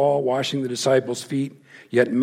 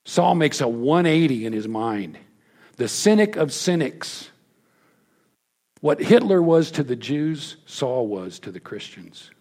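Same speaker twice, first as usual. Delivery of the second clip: usual speaking voice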